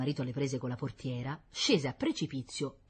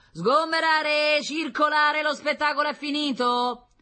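loud, high crowd-clearing call, first word drawn out; officious exclamation; 13 semitones higher, 8 dB louder, slower than usual. A narrator's reading. Man disperses the watching tenants, officious shout. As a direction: bellow orders like an official. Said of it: "con cipiglio professionale, esclamò".

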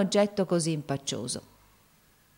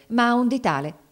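hasty correction; 6 semitones higher, 4 dB louder than usual. A narrator's reading. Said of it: "essa s'affrettò a correggere".

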